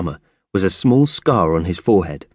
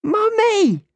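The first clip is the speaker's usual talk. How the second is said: put-on child calling. Angel calling after her mother, very high cry. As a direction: call after her once more. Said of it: "she shouted".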